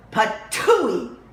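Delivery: loud spit-out patooey; hammed-up spit; spitting cry. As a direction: spit out the hot porridge. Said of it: "cried Goldilocks".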